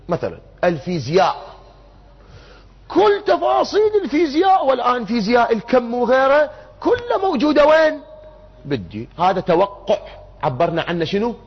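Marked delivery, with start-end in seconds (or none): 2.9-9.1 high, raised-voice proclamation; voicing maximalists at a high pitch; emphatic sweeping claim.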